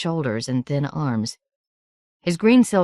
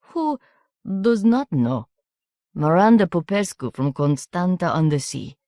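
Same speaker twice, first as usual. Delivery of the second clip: a hint of a slow Romanian accent; measured, knowing foreign boy; foreign boy, lightly voiced; slower than usual. A narrator's reading. Markasev telling Miranda he knows her family, lightly accented. Markasev tells of grandmother's stories.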